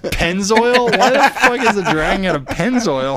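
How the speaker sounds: loud, high-pitched comic disbelief; mock incredulity. Viewer spotting a logo on the dragon, mock incredulity. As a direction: cry it out, high and laughing, in mock outrage. Joking outrage at a logo gag.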